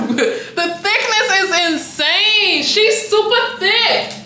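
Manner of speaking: high, drawn-out thrilled gushing; slow, high-pitched overexcited raving; dramatic amazement. Real, not acted. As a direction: stretch the words out high in disbelief. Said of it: "I'm being so dramatic".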